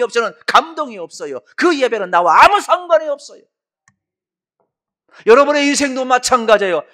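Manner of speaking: high, loud fervent preaching; preaching at full force. Real, not acted. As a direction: preach with fire.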